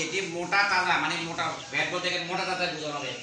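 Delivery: normal talking voice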